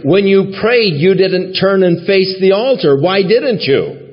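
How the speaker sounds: high, indignant retold demand; imitated anger. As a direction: imitate an upset churchgoer, pitch pushed well up. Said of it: "She was so angry".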